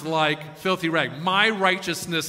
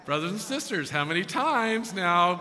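usual speaking voice

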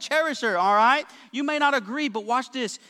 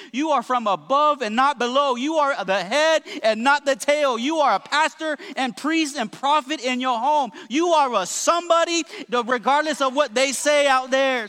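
louder, rising, rhythmic declarations; full-force preaching; 3 semitones higher, 4 dB louder than usual. Real, not acted. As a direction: build each declaration with conviction.

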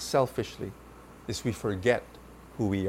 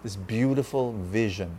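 prayer; 4 semitones lower than usual. Acted.

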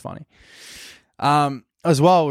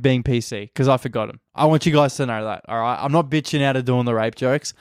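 normal talking voice

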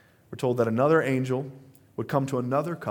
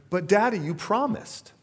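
put-on higher, cute three-year-old pleading; little girl voice; 8 semitones higher than usual. Small daughter pleading with daddy for promised ice cream, higher cute voice. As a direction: plead like a tiny girl who was promised. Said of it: "she says it in her little, like, voice that three and a half year olds have".

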